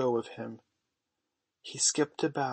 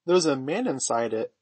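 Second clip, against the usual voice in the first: louder, astonished report; surprised discovery; 2 semitones higher, 6 dB louder than usual. A narrator's reading. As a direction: announce a startling find loudly. John discovers man in kennel.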